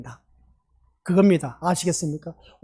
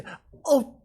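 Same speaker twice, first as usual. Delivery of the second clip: pained yelp; 8 semitones higher than usual. Retold or imitated. Older boy struck on the sole.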